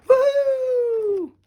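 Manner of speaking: very high, loud happy whoop; cheering whoop. Acted. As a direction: crowd cheer for the boy. Whole wedding cheers.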